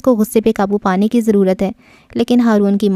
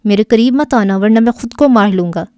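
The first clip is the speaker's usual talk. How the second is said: ordinary delivery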